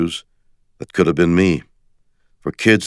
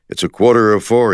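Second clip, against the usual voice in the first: lightly voiced fretful workaholic, higher and louder; a friend's teasing take on a man who can't stop working; mock impression of me; 6 semitones higher, 5 dB louder than usual. Friend teasingly imitating the narrator's workaholic worrying, a bit higher and louder. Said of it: "he'd say in his best Shatner".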